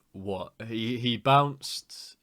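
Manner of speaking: ordinary talk, nothing marked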